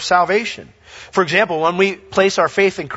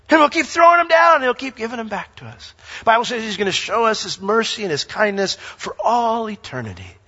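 ordinary talk

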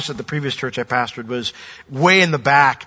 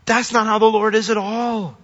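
ordinary talk, nothing marked

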